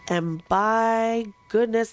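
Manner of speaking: slow, drawn-out Southern drawl; light Texan Southern accent. Retold or imitated. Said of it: "did she have a southern accent".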